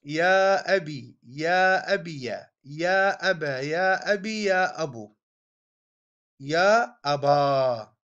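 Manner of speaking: each form of يا أبي spoken slowly and deliberately; listing forms. Shown as a demonstration. Teacher recites six vocative forms for students, slow and deliberate. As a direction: recite يا أبي، يا أبا، يا أبو as separate careful forms. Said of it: "يعني تقول".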